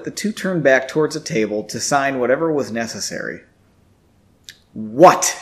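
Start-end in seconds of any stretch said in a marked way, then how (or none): 4.7-5.4 a touch of annoyance, louder; mildly irritated question; annoyed. A narrator's reading. Janie catching Henry's grin, mildly annoyed. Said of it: "she asked in an annoyed voice".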